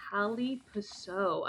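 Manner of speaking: "Cali Paso" sounded out slowly, piece by piece; sounding out. Retold or imitated. Puzzled reader trying a word on a big sign, sounding it out. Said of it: "sound it out, out loud".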